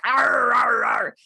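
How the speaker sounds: loud, high hammed-up growling roar; mock lion roar. Guest mocking how people imagine lions, loud mock roar. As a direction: play a snarling lion for laughs. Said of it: "They're like".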